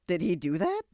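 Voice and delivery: loud, high disbelieving question; shocked. Real, not acted. Reacting to the musician's death, loud disbelief. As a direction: blurt it out in shock. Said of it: "shocked reaction to reading".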